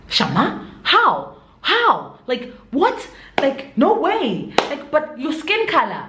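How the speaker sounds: mimicking baffled, high-pitched astonishment; incredulous mock questioning, pitch swooping up; floored disbelief. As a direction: act out people who can't believe it. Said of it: "they get floored when they hear".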